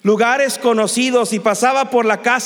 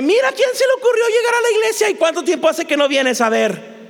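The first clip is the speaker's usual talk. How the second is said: much higher-pitched mock gossip; mocking busybody; 9 semitones higher than usual. Churchgoer remarking on someone's return to church, mock scandalized. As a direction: crow it in a high, scandalized voice.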